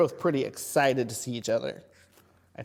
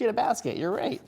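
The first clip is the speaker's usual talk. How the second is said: excited; 9 semitones higher, 5 dB louder than usual. Real, not acted. Praising child's answer.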